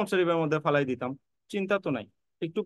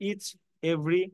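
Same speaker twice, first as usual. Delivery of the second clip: ordinary talk, nothing marked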